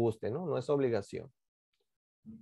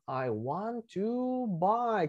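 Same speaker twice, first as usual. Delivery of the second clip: "I want to buy" stretched very slowly; slow model phrase; 5 dB quieter, much slower than usual. Shown as a demonstration. Teacher draws attention to the expression, slow model. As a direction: draw out each word for learners. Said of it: "Fíjense esta expresión".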